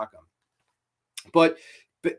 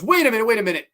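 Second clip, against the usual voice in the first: raised, louder mock interjection; retold urgent objection; 7 semitones higher, 5 dB louder, much faster than usual. One guy stops them cutting off the ear, urgent. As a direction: cut in urgently, imitating him.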